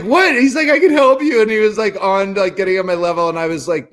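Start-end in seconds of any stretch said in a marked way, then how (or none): 0.0-1.4 high, loud and slowed alarm. Retold or imitated. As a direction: sound shocked then eager to help. Brother's alarmed response retold.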